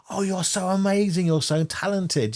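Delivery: high-pitched gushing admirer; mock adoring, pitched well up; gushing fan. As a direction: gush like a starstruck fan. Imitating gushing fans of [name].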